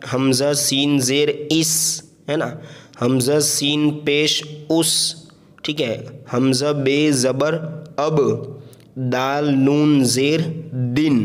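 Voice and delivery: letters named slowly one by one; slow patient spelling drill. Shown as a demonstration. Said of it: "हिज्जे करके पढ़े".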